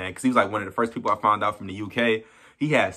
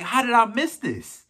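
disbelief; 10 semitones higher than usual. Retold or imitated. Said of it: "I'm like".

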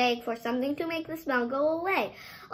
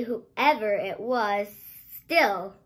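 usual speaking voice

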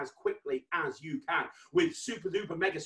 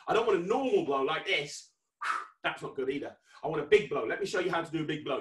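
normal talking voice

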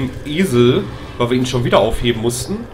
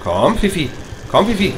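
louder and higher on the second call; 6 semitones higher, 4 dB louder than usual. Real, not acted. Player calling the dog Fifi, rising call.

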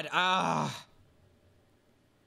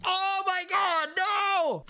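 high, loud cry of dismay; anguished shout; 5 dB louder, much slower than usual. Real, not acted. Streamer shouting at his failed jump, high and loud. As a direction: yell at the game as the jump fails.

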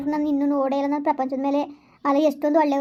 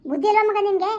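scolding father; 5 semitones higher than usual. Acted.

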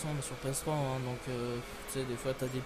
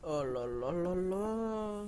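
drawn-out dismay; 2 semitones higher, much slower than usual. Real, not acted. Drawn-out slow sigh.

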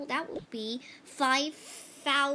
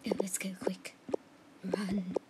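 urgent hushed whisper; 6 semitones lower, 4 dB quieter than usual. Pretend. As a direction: whisper it, hurry. Sneaking away.

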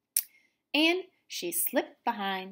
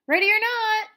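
calling out loud; 12 semitones higher, 6 dB louder, faster than usual. Acted. Counting done, seeking game starts.

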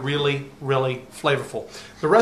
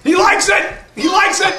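high, loud joyful cry; excited shout; 8 dB louder than usual. Real, not acted. Father cheering his picky son trying a mussel, loud and joyful. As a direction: celebrate like a winning goal.

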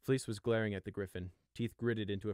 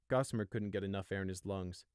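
usual speaking voice

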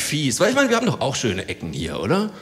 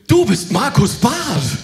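slow, loud, higher excited yell; excited shout; 8 semitones higher, 6 dB louder, much slower than usual. Retold or imitated. Jogger recognises comedian and shouts in excitement. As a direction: blurt it out starstruck. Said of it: "reißt die Augen auf und schreit".

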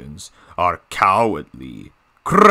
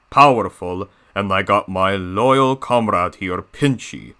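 Diesel 10. Acted. Villain diesel introducing himself to viewers, grand put-on character voice. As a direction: introduce yourself as a boastful, theatrical villain. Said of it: "doing my own Diesel ten voice impression".

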